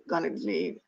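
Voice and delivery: ordinary talk, nothing marked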